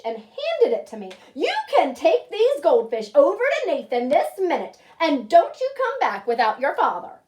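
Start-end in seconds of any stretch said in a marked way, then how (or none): 1.3-7.2 loud, firm telling-off; stern scolding. Acted. Mother ordering her son to fetch his father, loud and stern. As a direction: lay down the law to a naughty son. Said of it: "if she's very mad".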